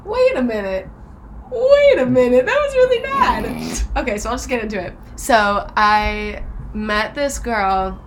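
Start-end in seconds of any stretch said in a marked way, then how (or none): 0.1-3.8 dawning realization. Retold or imitated. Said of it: "I was like".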